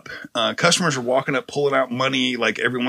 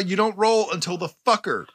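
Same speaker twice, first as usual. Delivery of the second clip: normal talking voice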